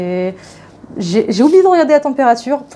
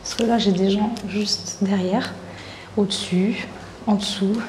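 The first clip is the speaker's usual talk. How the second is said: hushed, much softer voice; speaking more quietly; 4 semitones lower, 10 dB quieter than usual. Real, not acted. Vlogger in a guest house keeping her voice down, much softer. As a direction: keep it down, neighbours just behind the wall. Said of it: "je vais parler moins fort encore".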